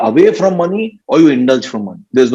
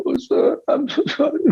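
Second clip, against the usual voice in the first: mock tearful apology; 10 semitones higher, 7 dB quieter than usual. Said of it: "Start crying".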